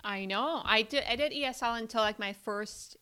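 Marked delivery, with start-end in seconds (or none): none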